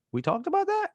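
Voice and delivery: high, incredulous question; disbelieving. Retold or imitated. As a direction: act stunned you forgot this. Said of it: "and I'm like".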